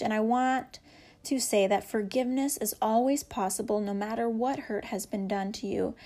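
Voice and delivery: usual speaking voice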